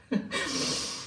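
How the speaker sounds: soft, breath-only laugh; quiet laugh. Real, not acted. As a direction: laugh softly at your own joke. Amused by own naming joke.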